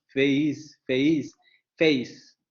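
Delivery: "face" with the glide weak and the first vowel strong; correct "face". Shown as a demonstration. Teacher correcting students' pronunciation, then the model. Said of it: "no decimos face".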